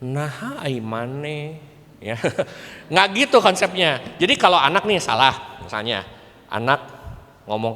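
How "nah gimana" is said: very soft, sweet coaxing; mock soft-spoken. Mocking a parent who scolds too softly. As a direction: coo it too gently, mocking. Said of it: "Tapi bukan pakai soft spoken".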